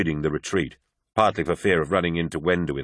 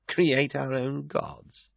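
slow, higher, disbelieving question; incredulous echo; 7 semitones higher, 4 dB quieter, much slower than usual. Acted. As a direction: drag it out in disbelief. Carcassy echoes her in disbelief.

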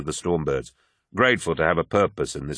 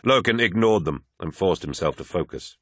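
usual speaking voice

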